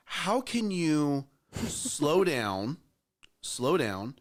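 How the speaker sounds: slow right down as you say it; drawn-out slow down. Acted. Host acting out slowing down for listening parents. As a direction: stretch the words out very slowly, with a laugh.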